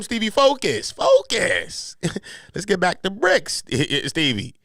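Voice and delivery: high, loud mock scolding; calling to attention. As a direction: snap Stevie back to attention. Acted calling of Stevie to attention.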